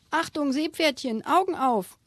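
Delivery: doctor calls out to the speeding seahorse; calling out. Acted. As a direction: shout a quick warning while braking hard. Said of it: "rief Tiefsee-Doktor Theodor".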